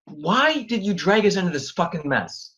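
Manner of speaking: high, heated question; exasperated demand. Acted. Dragged into the mess, confronting her in exasperation. Angry confrontation after the fight.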